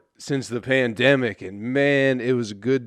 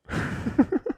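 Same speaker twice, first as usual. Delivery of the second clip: high breathy laugh; 4 dB quieter than usual. Real, not acted. Laughing at the memory.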